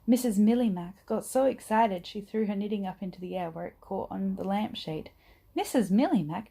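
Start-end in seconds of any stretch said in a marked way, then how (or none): none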